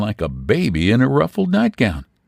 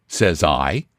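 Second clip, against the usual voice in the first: usual speaking voice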